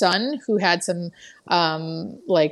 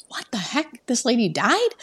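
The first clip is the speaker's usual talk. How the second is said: higher, startled questioning; retold disbelief; 4 semitones higher than usual. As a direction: react in shocked disbelief, as retold. Reacting to unexpected death in retelling.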